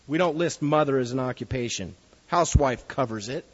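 usual speaking voice

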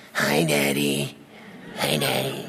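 put-on low, slow, raspy toddler voice; raspy little boy voice. Pastor imitating his young son greeting him, low and raspy. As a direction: croak it out like a small boy with a raspy voice. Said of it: "he had a raspy voice".

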